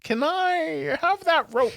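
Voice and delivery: high, slow, overly polite ask; sweetly request the rope; mock polite Jimmy Stewart. Jimmy Stewart quote in retelling.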